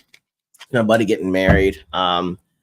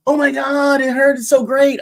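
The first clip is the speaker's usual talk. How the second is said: much higher, excited gushing guest; imitating a guest won over by Texas; gushing delight; 5 dB louder than usual. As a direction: squeal it like a thrilled fan. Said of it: "guest gushing".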